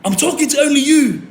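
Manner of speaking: high mock loudspeaker call; officer on speaker. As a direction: imitate police calling over a speaker. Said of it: "saying on his speaker".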